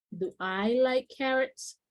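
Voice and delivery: each English word set apart, slowly; slow model sentence. Shown as a demonstration. Teacher reading the example question to the class, slowly. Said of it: "Muy bien".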